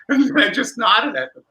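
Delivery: can't hold back the giggles while telling it; laughing. Real, not acted. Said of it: "I started cracking up".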